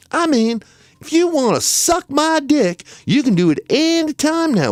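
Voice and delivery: a whiny defendant talking back to the judge; high mock voice of a defendant complaining to the judge. Defendant voice taunting.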